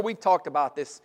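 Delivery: ordinary talk, nothing marked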